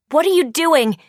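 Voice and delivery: shouting. Acted. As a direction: shout up at her, alarmed. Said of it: "Frankie shouts out".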